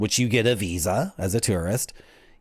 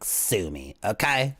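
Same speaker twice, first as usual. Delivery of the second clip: ordinary talk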